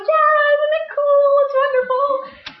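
very high, singsong squeal; mock gleeful mother. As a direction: gush along with the kids, stretching the words. Mother matching her excited kids.

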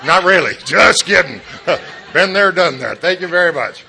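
loud, high, laughing quip; loud joking. Real, not acted. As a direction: shout the joke out with a grin. Joking to crowd, big laugh.

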